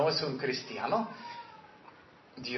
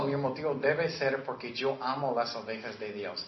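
normal talking voice

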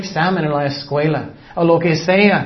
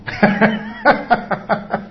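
a light airy laugh; breathy laugh; 5 semitones higher than usual. Real, not acted. Preacher laughing at his own mock prayer, airy.

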